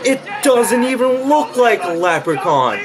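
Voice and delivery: laughing, outraged disbelief; disbelieving outburst. Real, not acted. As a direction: react in stunned disbelief at how bad it looks. Disbelief at the leprechaun design.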